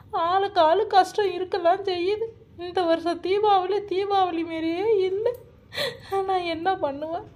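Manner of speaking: high-pitched woeful wail; plaintive lament. Acted. Woman laments everyone's hardship to herself.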